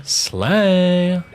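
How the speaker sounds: high, loud diva shout of slay; sassy slay cheer. Pretend. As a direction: belt it like a hyped-up diva. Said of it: "Can you do a slay with your great voice acting?".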